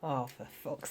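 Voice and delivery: ordinary talk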